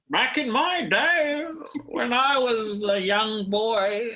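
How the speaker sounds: put-on high, slow old-man reminiscing. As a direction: creak it out like a granddad telling tales. Imagining himself as old man.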